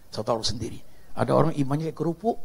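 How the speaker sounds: ordinary talk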